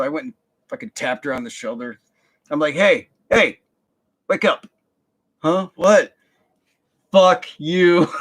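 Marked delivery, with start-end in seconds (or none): 2.7-8.1 loud, high hey wake up and fuck you; angry confrontation. Retold or imitated. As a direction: wake her then curse her out. Waking cheating girlfriend, confronting.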